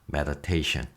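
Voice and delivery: soft, low, meditative murmur; drowsy calm. Real, not acted. Teacher lulled by the word while recording, drowsy calm. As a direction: let the word sink, eyes nearly closing. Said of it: "저절로 선생님 눈이 감기네".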